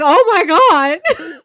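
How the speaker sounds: high, loud mock-stunned exclamation; retold shock. Co-host reliving the scene's impact, retold shock. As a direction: act out being floored.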